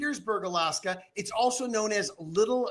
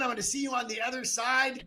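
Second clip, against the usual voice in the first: ordinary talk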